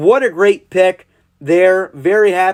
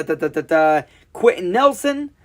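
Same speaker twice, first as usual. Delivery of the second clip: ordinary talk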